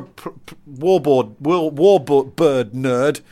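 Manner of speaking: slow, effortful attempt at a tongue-twister; stumble, then pick through it very slowly; tripping over words. Real, not acted. Podcaster wrestling with a tricky phrase, slow and tongue-tied. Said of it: "it's more difficult than I thought it was".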